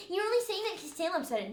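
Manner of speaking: high, fast mock accusing; retold accusation. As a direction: quote him griping, high and hurried.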